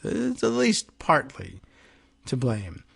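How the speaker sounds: timidly conceding a small point; mock reasonable. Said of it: "in their quest to be reasonable".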